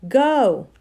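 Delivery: loud, high urgent shout. A narrator's reading. Digory giving Polly the signal, urgent shout. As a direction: yell it as a command. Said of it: "shouting to Polly".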